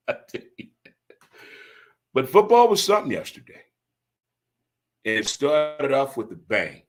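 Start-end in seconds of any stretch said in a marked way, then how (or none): 2.1-3.6 excited. Real, not acted. Excited about football.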